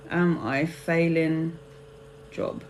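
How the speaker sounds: slow, low and soft, trailing away; slow dictation. Real, not acted. Tarot reader noting Chris's question, softly dictating to self. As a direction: murmur it word by word as if jotting it down.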